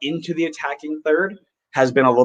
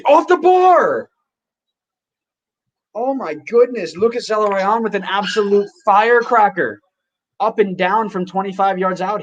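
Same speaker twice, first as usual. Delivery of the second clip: high, excited rush over a near goal; excited play-by-play; 8 semitones higher than usual. Real, not acted. Fan calling a long-range shot live, high and excited. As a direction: call it like a thunderbolt just hit the bar.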